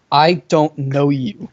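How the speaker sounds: slow, deadpan imitation. Boy staring a girl dead in the eye, slow deadpan. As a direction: stretch it out slowly, as if staring her down. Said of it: "looks her dead in the eye".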